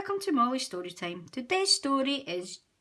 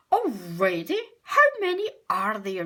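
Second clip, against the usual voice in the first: louder, exasperated grumble; irritated complaint about batteries; grumbling; 7 dB louder than usual. A narrator's reading. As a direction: grumble like a fed-up dad. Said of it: "grumbles Daddy Pig".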